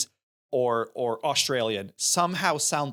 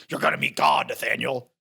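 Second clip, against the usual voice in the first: mocking a bad impression of a friend; louder mock character voice on GOD; Sadie; 4 dB louder than usual. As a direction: mimic the put-on voice with mild force. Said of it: "Why is Sadie's voice".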